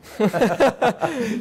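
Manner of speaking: short high, loud laugh; amused laugh. Real, not acted. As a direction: burst out laughing briefly. Amused at refusing the question.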